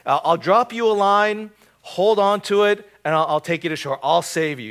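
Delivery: loud, high call from above over the noise; shouting down. Retold or imitated. Helicopter pilot shouting down to the drowning man, loud and high. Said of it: "the helicopter operator shouts down".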